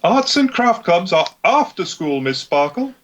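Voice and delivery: strict teacher correcting a pupil; Gisela voice. Acted. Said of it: "I'll take, uh, Gisela".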